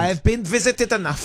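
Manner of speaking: put-on thick German accent, high-pitched. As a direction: say it like a stern German host. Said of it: "but in a German accent".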